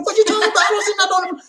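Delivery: very high, comic protest; squeaky mock indignation; acting out bewildered self. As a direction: play up baffled innocence. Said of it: "I said".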